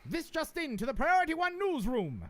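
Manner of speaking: put-on high, loud newsreel announcer accent; Transatlantic accent. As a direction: project it from the diaphragm, newsreel style. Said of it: "get myself into character".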